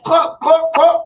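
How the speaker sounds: high, loud clucking; chicken cluck. Retold or imitated. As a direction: cluck it like a hen. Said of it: "the chicken say, like".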